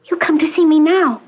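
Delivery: put-on high, reproachful girl's voice; hurt girl. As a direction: accuse the mother who stayed away. Patient reproaches mother.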